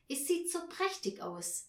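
put-on high little girl; admiring child. Girl admires the party set, high and dreamy. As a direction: sigh it out like a dazzled child.

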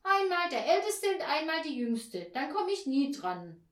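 high, loud complaint; worked-up child. A narrator's reading. Peter fears missing his turn, indignant high voice. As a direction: argue like an aggrieved boy. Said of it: "regte sich Peter auf".

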